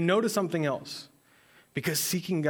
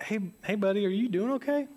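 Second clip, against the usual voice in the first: gentle dad check-in; 8 semitones higher, faster than usual. Retold or imitated. Dad checking on sick son.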